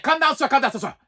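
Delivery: high-pitched mock yell in a foreign accent; excited mock foreign-accent yelling; imitated accented yelling. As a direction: mimic an agent rattling out yelled orders. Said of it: "now he's yelling at me".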